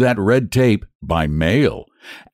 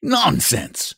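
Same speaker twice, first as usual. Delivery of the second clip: high exasperated shout; angry outburst, higher; 12 semitones higher than usual. Acted. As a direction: spit the word out. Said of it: "he shouted".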